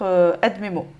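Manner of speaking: normal talking voice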